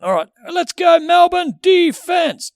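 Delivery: loud high arena chant; rev up guy. Retold or imitated. Complaining about the rev up guy, shouted defense chant. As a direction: pump up the crowd like an MC. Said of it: "it's just fucking constant".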